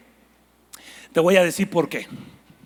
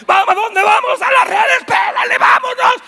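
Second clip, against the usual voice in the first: excited shouting; 9 semitones higher, 5 dB louder than usual. Acted. Fish answer the Lord's call.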